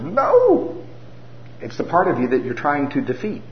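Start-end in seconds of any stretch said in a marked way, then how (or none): 0.0-0.8 sharp high no; mock flat refusal; stubborn refusal. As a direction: balk like a stubborn child. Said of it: "and your goes".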